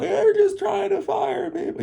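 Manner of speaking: very high mock shriek; outraged socialists. Socialists watching this video complain, hammed-up outrage. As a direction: squeal it like a hammed-up protester. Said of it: "all the socialists out there".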